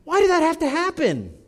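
high, strained cry of protest to God; anguished cry. Retold or imitated. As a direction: cry out why, near breaking. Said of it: "I cry out to God and I say".

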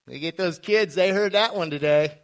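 loud mock cheer of a parent; hammed-up parental triumph, loud; gloating parent. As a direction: play a parent crowing over the kids. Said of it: "You're like".

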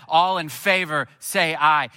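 loud, drawn-out call to vote; pastor calling a vote. Retold or imitated. Pastor to the congregation at a business meeting, calling a vote. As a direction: put it to the room, slow and loud.

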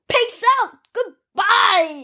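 high loud sign-off; cheerful goodbye. Real, not acted. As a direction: shout the farewell to viewers. Signing off the show.

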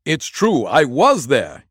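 slow, loud, laughing relief; joyful relief. A narrator's reading. Prisoner relieved at last, joyful. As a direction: burst out with glad relief. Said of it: "nearly laughed for joy".

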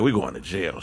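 low, soft resigned mutter; despairing friends. Retold or imitated. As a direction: murmur it like doom is certain.

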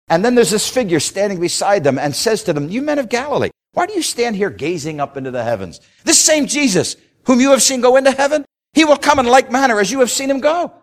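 high, loud preacher's build; preaching at full force. Acted. Preacher to congregation telling of Elijah, full-force preaching. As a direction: ring it out to the congregation.

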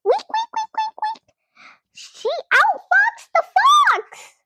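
very high squeaky wheeks; guinea pig weeping. Acted. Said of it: "weeped Rosie".

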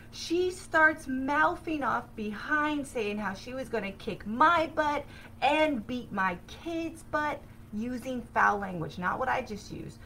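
ordinary delivery

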